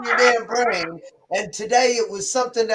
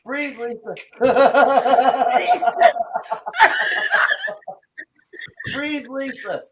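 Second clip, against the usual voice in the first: laughing; 6 semitones higher, 4 dB louder, slower than usual. Real, not acted. Laughing too hard to breathe.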